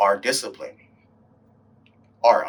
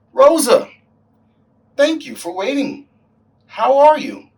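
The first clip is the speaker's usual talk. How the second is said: bright high greeting; 11 dB louder than usual. Acted. Greeting the older lady Rosa.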